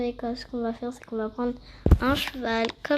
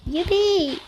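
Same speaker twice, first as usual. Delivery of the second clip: high gleeful youpi; excited cheer; 7 semitones higher, 4 dB louder than usual. Real, not acted. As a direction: cheer it out happily. Eager to try the ride.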